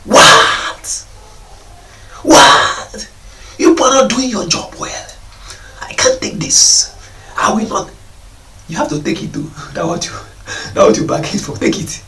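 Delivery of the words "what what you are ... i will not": loud, indignant outburst in a retold parent's voice; mock shouting parent. Parent shouting at teachers over a child's injury. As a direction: blast the teacher as an outraged parent would. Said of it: "you start shouting".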